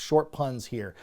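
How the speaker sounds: ordinary delivery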